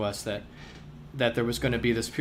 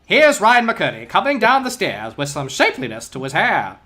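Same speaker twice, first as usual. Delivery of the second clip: brisk 1940s newsreel announcer, louder; old newsreel; 5 semitones higher, 8 dB louder than usual. Pretend. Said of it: "that was an appropriate newsreel voice".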